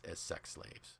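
a hushed, grave whisper. Retold or imitated. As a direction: breathe it out in a whisper, voice dropped right away. Quoting a grim outcome softly.

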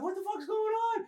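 high, soft squeak of confusion; mock bafflement in a small high voice; bewildered. Arnold thanked and taken by strangers, bewildered.

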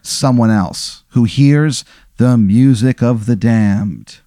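slow, weighty delivery; solemn, drawn-out pronouncement; portentous revelation. Acted. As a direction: space the phrases out like a grave confession. Character makes portentous revelation.

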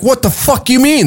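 high-pitched, indignant outburst; fired-up Texan. Retold or imitated. Texan told he can't enter Canada, fired-up indignation. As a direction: imitate an outraged Texan, pitch jumping up. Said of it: "Texans get fired up".

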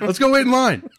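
high, loud eager cry; hammed-up giddy shout; excited urging. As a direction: act out rushing everyone along. Retold excited rush to cinema.

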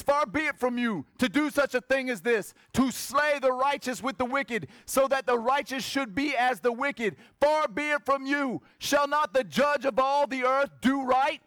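high, forceful scripture reading; bold challenge. Acted. Abraham challenging God to spare the righteous, bold.

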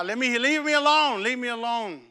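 high, loud cry to be left alone; injured man shouting to be left; pleading shout. Retold or imitated. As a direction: push them away with your voice. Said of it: "and I said".

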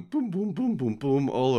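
bouncy, high run of booms; playful falling sound effect; imitated tumbling thuds. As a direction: sound out each bump down the stairs. Imitating a body tumbling downstairs.

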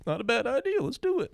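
very high-pitched put-on voice; gullible stoner. High comic believing Trump's bleach speech, gullible stoner. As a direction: pitch it way up, easily convinced.

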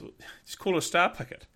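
high, laughing "just call it"; mock dismissive. Australian telling the Kiwi to just say star picket, high and dismissive. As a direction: brush it off like it's obvious. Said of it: "And he goes".

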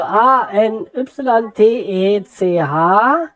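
letter by letter, slow; slow spelling. Real, not acted. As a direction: spell it out carefully, checking. Spells address back slowly. (langsam buchstabierend)

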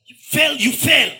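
loud, high shout of you fell; shouting fall. Acted. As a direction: cry out that they fell. Preacher acting out soldiers falling.

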